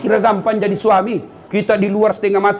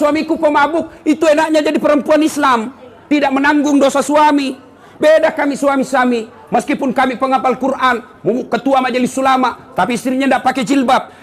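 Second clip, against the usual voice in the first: full force preaching; 8 semitones higher than usual. Acted. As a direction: hammer it home to the crowd.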